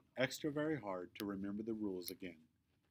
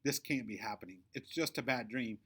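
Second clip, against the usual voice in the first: plain reading voice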